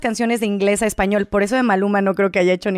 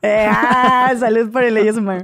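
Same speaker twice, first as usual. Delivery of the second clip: laughing toast; 3 dB louder than usual. Real, not acted. Laughing toast over drinks.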